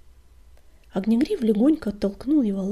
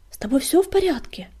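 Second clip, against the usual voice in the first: anxious, higher, stress on всё; worried question; 7 semitones higher, 5 dB louder than usual. Acted. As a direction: ask it with sudden concern. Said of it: "спросил он".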